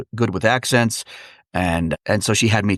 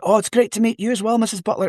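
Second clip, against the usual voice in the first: high, polite put-on Scottish greeting; playing at being Scottish, pitched up; pretend Scottish; 10 semitones higher than usual. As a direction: greet her in a faked Scottish accent. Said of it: "pretend to be Scottish".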